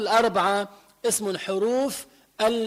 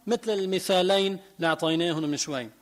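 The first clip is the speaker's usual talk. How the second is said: ordinary talk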